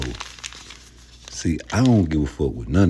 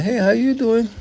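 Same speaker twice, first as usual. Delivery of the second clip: much higher squeaky mock greeting. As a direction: pitch it way up like a caricature. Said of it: "you're like".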